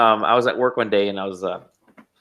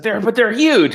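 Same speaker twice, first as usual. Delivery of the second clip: high-pitched, starstruck protest; imitated astonishment; 10 semitones higher, 4 dB louder, slower than usual. Director protests that his employee's friends are famous, imitated astonishment. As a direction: play the director stumbling in disbelief.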